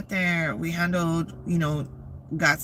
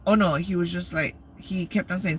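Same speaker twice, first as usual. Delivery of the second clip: usual speaking voice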